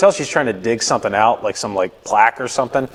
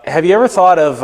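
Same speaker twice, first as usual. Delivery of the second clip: higher-pitched, casual dentist suggestion; mock nonchalant; 6 semitones higher, 4 dB louder than usual. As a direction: imitate a dentist raising it offhandedly.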